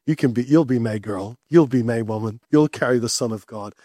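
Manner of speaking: a low, kindly heavenly messenger; gentle angel. Acted. God voiced low and tender.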